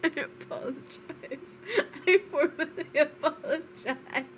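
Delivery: breathless giggly apology; mock formal apology through laughter; laughing apology. Real, not acted. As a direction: try to sound formal but keep laughing. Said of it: "She says as she's laughing".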